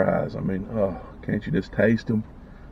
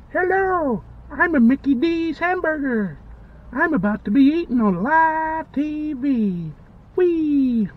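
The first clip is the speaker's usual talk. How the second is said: very high, cartoonish talking-food voice; squeaky hamburger voice; 3 dB louder than usual. Hamburger cheerfully greeting viewers before being eaten, in a very high cartoon voice. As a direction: play the burger, chirpy and doomed.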